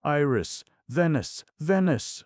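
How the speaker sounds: high, loud frantic calls; frantic shouting, near tears; frantic calling. Acted. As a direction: scream the names in panic. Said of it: "Shirley was sobbing".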